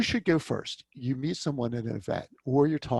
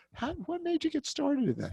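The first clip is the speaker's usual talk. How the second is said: much higher, lively question; with enthusiasm; 14 semitones higher, 3 dB quieter than usual. Shown as a demonstration. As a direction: sound genuinely eager to hear the answer. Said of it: "say it with some enthusiasm".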